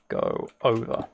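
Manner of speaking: ordinary talk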